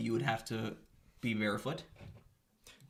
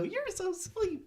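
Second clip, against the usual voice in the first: very high, squeaky little fairy voice. Retold or imitated. Ghost of Christmas Present teasing Bill Murray, very high squeak. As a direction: squeak it out like a tiny punching fairy. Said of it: "She had a super high, squeaky voice".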